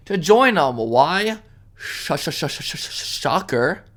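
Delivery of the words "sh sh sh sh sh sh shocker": whispered stuttering build to shocker; sarcastic stammer. Pretend. Ranter teasing a punchline to viewers, mock stammer. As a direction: fake a stammer, then drop the word.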